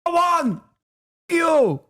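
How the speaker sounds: put-on very high-pitched, indignant Israeli accent; hammed-up Israeli accent, squealing in disbelief; mock Israeli accent. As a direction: act scandalized in an exaggerated Israeli accent. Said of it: "pretty good Israeli accent".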